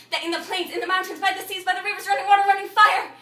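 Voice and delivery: urgent, rising torrent of words; mounting rant. Acted. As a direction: surge through the list to a loud peak. Acted rant, list builds.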